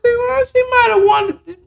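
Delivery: high, sassy aside; sassy retort. Retold or imitated. Retelling her own sassy retort about the killing, high pitched. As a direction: toss it off high with attitude.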